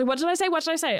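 high-pitched mock fluster; retold panic. Retelling a contestant caught lying about her age, mock panic. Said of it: "and then she panics".

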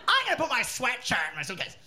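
high-pitched mock American announcement; Nancy. Nancy announces her thought at the airport, mock American. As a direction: announce it to everyone in an American accent. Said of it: "thought she would announce that".

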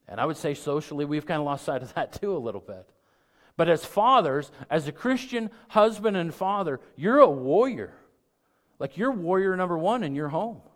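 loud and high, calling fathers to be warriors; rally the men in the pews with a booming cry; preaching at full force. Acted.

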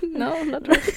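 laughing, caught out. Real, not acted. Admitting she was joking, laughing. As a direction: giggle through the denial.

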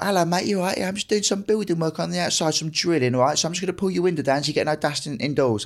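a lightly voiced builder explaining drilling work outside. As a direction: chat to the homeowner like a friendly builder. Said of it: "He's like".

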